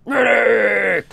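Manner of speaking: loud crying callout; shouted medic call. Pretend. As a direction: yell for a medic. Spamming the medic voice line.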